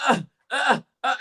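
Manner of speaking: high mimed grunts of effort; imitated struggle, grunt by grunt; straining grunts. Imitating straining at the goal line.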